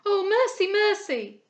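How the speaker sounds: high, loud cry of horror; girl recoiling in horror at a sickbed; horrified screaming. A narrator's reading. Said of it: "screaming with unrestrained horror".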